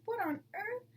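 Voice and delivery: high astonished exclamation; surprised cry. A narrator's reading. Weak mother fox seeing the hens, astonished. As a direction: gasp it out in disbelief. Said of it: "she cried".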